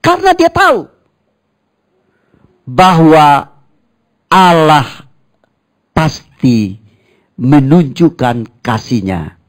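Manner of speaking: loud, high preacher's call to the church; preaching. Acted. Pastor preaching loudly to the congregation. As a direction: cry it out like a revival preacher.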